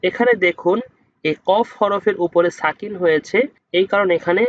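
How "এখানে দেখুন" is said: ordinary talk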